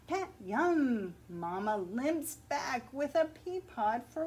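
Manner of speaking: put-on lively picture-book read-aloud for children; story narration. A narrator's reading. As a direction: read it like story time at the library.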